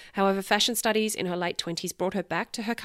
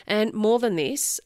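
ordinary talk, nothing marked

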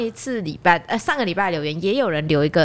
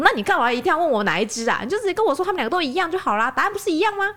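rising, faster, exasperated complaint; impatient reporter; 9 semitones higher, faster than usual. Retold or imitated. Joking reporter fed up with the farmer, mock exasperated. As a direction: retell the reporter losing patience. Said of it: "他就不耐烦".

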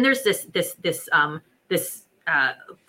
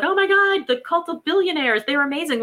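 gushing admirers; 8 semitones higher than usual. Retold or imitated. Admirers raving over the cult of billionaires, gushing high. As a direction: gush like an awed fan. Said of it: "all these people that were like".

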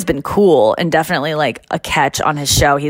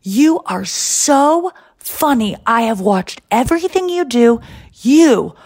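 slow, drawn-out gushing, pitched up; gushing fan; 5 semitones higher, slower than usual. Retold or imitated. Jerry greeting the comic he adores, slow emphatic gushing. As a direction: imitate a star-struck fan, stretching the key words. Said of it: "he says something like".

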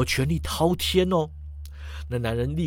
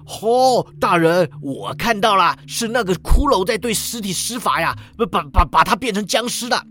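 louder, higher, stammering accusation; alarmed outcry; 5 semitones higher, 4 dB louder than usual. Acted. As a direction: call out in acted panic, tripping over the words. Prisoner alarmed, calling out an accusation. (惊慌喊叫，结巴告状)

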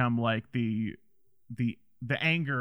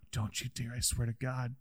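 hushed mock warning; quiet threat; 10 dB quieter than usual. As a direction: drop the volume way down to threaten. Acted low threat from film.

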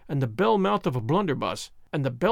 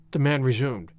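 ordinary delivery, nothing marked

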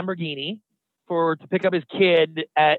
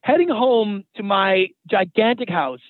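ordinary delivery

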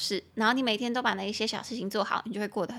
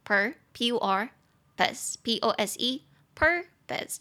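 very slow, spelling out per and pus; syllable by syllable; much slower than usual. Shown as a demonstration. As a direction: break "purpose" into syllables, very slowly. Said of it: "中音节在第一音节".

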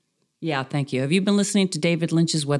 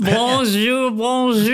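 high, singsong French greeting; hammed-up French, pitched up; mock French accent; 7 semitones higher, 3 dB louder, much slower than usual. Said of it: "With a terrible accent".

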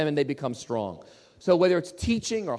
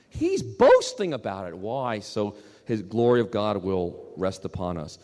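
ordinary talk, nothing marked

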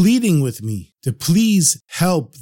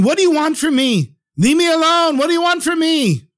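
upset, half-crazed man; 11 semitones higher than usual. Acted. Master of the house pleading with God, upset and high-pitched. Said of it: "he was so upset. He kept saying".

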